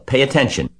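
high, loud command; screamed order. A narrator's reading. Wilbur to the sleepy rat, screamed order. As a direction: yell for attention. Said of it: "screamed Wilbur".

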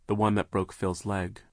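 ordinary delivery

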